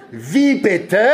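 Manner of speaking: high, outraged question; indignant cry. A narrator's reading. Middle brother objects to father's generous scoring, indignant. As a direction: exclaim it in high-pitched disbelief. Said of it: "rief mein mittlerer Bruder entrüstet".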